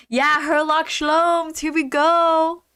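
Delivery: drawn-out, high cheer; hyped up. Real, not acted. Streamer kicking off the game, hyped.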